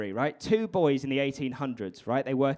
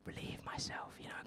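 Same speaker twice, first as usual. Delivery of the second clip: near-whispered, embarrassed admission; softened, confidential hush; hushed confession; 20 dB quieter than usual. Real, not acted. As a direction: drop to a whisper for the crude bit. Embarrassed crude confession, unvoiced.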